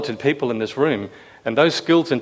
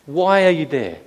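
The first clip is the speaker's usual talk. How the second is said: high, loud, slow exasperated questioning; exasperated God; 6 semitones higher, 6 dB louder, slower than usual. Retold or imitated. God scolding his people lying face down, slow exasperated questions. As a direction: let the frustration show, slowly. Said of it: "a real exasperation in God".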